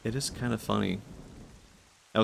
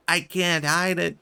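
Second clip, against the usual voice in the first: high, loud, stretched-out exclamation; mock uncontainable excitement; 8 semitones higher, 5 dB louder, slower than usual. Streamer showing off his new personality, over-excited. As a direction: belt it out in hammed-up thrill. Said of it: "You're so exciting, just can't hide it".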